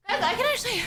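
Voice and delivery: winded, thought cut short by a heavy exhale; out of breath. Real, not acted. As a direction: talk while catching your breath. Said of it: "Even though I'm out of breath".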